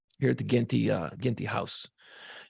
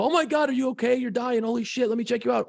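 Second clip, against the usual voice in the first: panicked parent; 9 semitones higher than usual. Retold or imitated. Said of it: "Run over and go".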